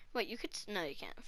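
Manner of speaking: hushed whisper to himself. Real, not acted. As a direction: mutter it under your breath, unvoiced. Thinking aloud to himself.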